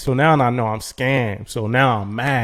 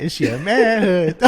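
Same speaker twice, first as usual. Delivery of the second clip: slow, laughing through the words; 8 semitones higher, slower than usual. Real, not acted. Joking with his friend about wounded pride, slow and laughing. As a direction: laugh it out at himself.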